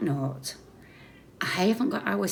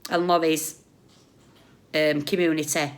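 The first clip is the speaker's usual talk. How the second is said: ordinary talk, nothing marked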